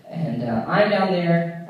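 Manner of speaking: ordinary talk, nothing marked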